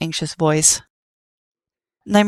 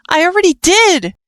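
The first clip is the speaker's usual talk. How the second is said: high, loud shout; shouted back; 13 semitones higher, 6 dB louder than usual. Acted. Frisk shouting back at Nightmare, loud and high. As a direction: fire it right back at him. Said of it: "Frisk shouted back".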